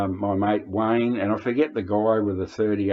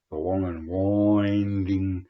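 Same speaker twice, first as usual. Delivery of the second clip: drawn out slowly; much slower than usual. Shown as a demonstration. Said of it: "you know, drawn out".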